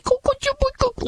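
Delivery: very high, soft shushing coo; soothing coo. Retold or imitated. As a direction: hush a crying child mockingly. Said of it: "like, calm him down".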